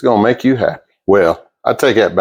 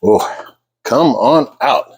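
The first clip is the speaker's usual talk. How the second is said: ordinary talk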